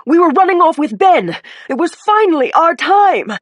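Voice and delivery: high, loud angry shouting; furious yelling. A narrator's reading. Woman yelling at the detective. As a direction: rage at him through pain.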